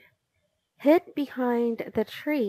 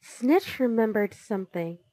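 ordinary delivery, nothing marked

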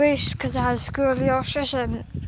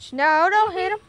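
ordinary talk

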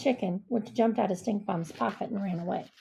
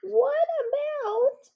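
high badger voice; 3 dB louder, much slower than usual. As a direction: voice pitched up high and slow, a worried little badger. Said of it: "said Stuart the Badger".

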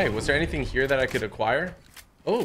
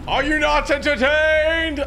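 gladiator shout; 11 semitones higher, slower than usual. Pretend. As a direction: bellow it to the crowd. Gladiator quote after a kill.

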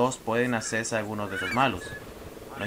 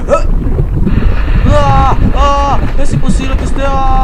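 very loud high screams; screaming; 12 semitones higher, 18 dB louder than usual. Real, not acted. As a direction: scream in desperation. Dying in game, screaming.

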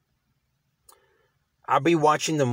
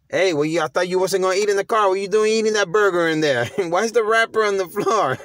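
higher, louder, laughing mock scold; 7 semitones higher, 4 dB louder than usual. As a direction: tease someone caught eating in the car. Voicing someone scolding car mess.

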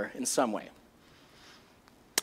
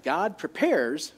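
slow emphasis; 6 dB quieter than usual. Acted. Stating the first truth.

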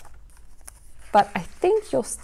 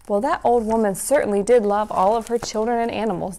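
usual speaking voice

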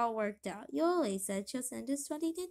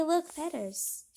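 ordinary delivery

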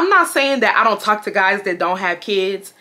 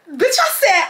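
laughing. Real, not acted. Bursts out laughing.

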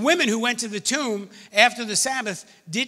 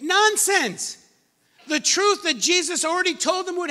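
"Nonsense!" thrown up high in mock disbelief; incredulous exclaim; 10 semitones higher, 4 dB louder than usual. Preacher mocking the disciples' verdict, incredulous. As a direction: scoff at the word like it's absurd.

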